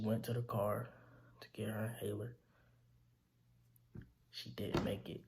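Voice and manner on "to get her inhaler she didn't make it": whispered, voice giving out; barely able to say it; hushed grief. Real, not acted. Son telling how his mother died, whispering in grief.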